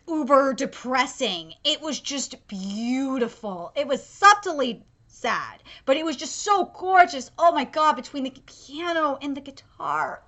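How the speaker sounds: ordinary delivery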